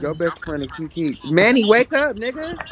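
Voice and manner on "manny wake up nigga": calling out. Real, not acted. Calling out to sleeping friend.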